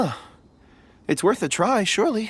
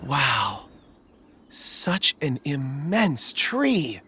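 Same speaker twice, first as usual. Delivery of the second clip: awed wonder; much slower than usual. Acted. Marveling at a giant tree.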